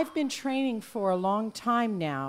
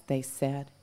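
ordinary delivery

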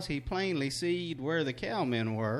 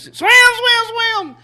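shouting; 17 dB louder, slower than usual. Retold or imitated. Brother yelling at the dog.

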